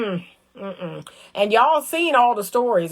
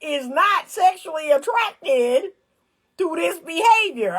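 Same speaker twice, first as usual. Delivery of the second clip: higher, slow, laughing mock man's realization; mock dawning voice with a laugh, higher; 6 semitones higher, slower than usual. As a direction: play the man finally getting it, amused.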